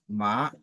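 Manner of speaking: "ma" gliding upward; drawn-out rising "ma". Shown as a demonstration. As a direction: start "ma" low and let it climb. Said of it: "ça monte".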